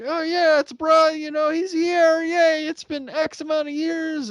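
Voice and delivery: high, loud cheerful greeting; cordial excitement. Retold or imitated. Cast greeting a returning actor, high loud cheer. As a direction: greet an old friend warmly and loudly. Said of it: "it starts off cordial".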